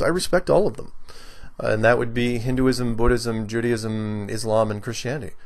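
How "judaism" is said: ordinary talk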